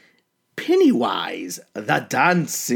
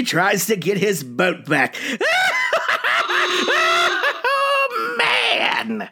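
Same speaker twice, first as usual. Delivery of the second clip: cackling madman horror host; jack o lantern voice; 12 semitones higher than usual. Acted. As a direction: play the unhinged asylum ghoul. Uncle Wiggles creepy host character.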